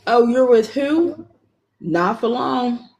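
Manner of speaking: usual speaking voice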